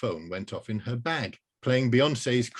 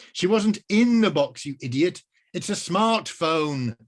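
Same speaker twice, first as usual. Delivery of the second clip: higher, louder scolding; 7 semitones higher, 6 dB louder than usual. Acted. Rose scolding the wizard, calling him an idiot.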